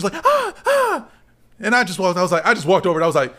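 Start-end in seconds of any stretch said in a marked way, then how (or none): 0.3-1.1 imitating a lady's very high startled shriek; hammed-up high-pitched shriek; mock scream. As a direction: squeal like the startled shopper behind you. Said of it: "the lady in the back screamed".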